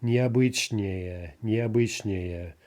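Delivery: "Необычнее" very slowly, stress kept on -быч-; no shifting stress. Shown as a demonstration. Teacher demonstrating a longer adjective, "Необычнее" slow with stem stress. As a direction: draw out "Необычнее" with stem stress, ending unstressed. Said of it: "so no shifting stress".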